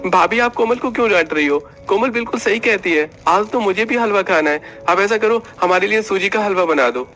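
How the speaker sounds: brother-in-law demanding halwa, playful; young uncle voice. Acted. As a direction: pitch up, teasing the sister-in-law. Narrator voices Nilesh.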